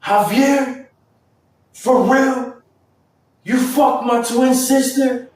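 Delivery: put-on high, loud, stunned girl voice; shocked sister. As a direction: play the hurt twin catching him out. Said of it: "this girl says".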